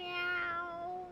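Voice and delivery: high, long-drawn meow; cat meow. Pretend. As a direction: mimic a cat's meow. Imitates a cat out of nowhere.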